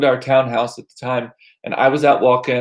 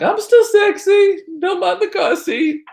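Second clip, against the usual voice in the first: high, slow, put-on sexy voice; mock seductive; 3 dB louder, slower than usual. Mocking the guy with a car seat, high and slow. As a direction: camp it up as the pickup guy. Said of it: "I'm like".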